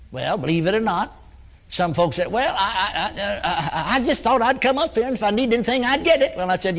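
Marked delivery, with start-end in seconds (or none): 2.3-6.4 high, halting nervous voice with laughs; a nervous laughing stammer, going higher; stammering giggle. Retold or imitated. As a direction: mimic a flustered churchgoer laughing it off. Timid churchgoer stammering an answer.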